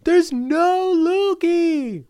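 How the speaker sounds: very high, loud loony voice; loopy high demo voice; silly put-on voice. Shown as a demonstration. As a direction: pitch it way up and stretch the last words. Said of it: "when I go like this".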